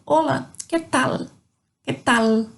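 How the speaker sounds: "tal" with a clear Spanish l at the end; tongue up to finish "tal"; corrected "que tal", no "tau"; true L. Shown as a demonstration. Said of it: "É o L com som propriamente do L".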